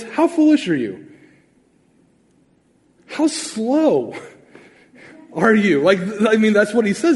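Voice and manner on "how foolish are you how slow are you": rebuking. Retold or imitated. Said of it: "He goes".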